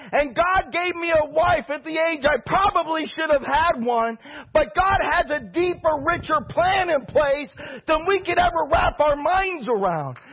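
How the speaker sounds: full-force preacher's fire; fervent preaching. Acted.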